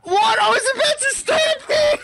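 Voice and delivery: high, loud, laughing outcry; excited shout. Real, not acted. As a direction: yelp it out in disbelief. Frustrated outburst during fight.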